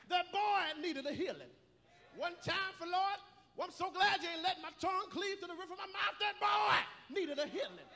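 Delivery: loud, rising sermon cadence; preaching at full force. Acted.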